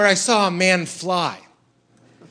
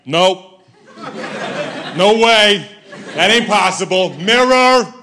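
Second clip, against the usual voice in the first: mocking skeptic; 2 semitones higher than usual. Comic mocking a skeptical guy at a magic show. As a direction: sneer it like a know-it-all skeptic.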